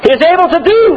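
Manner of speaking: high ringing shout of the preacher; Scottish preacher shouting from the pulpit. Retold or imitated. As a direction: proclaim it to the rafters. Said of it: "then he would say".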